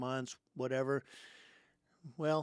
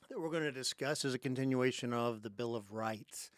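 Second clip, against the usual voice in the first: normal talking voice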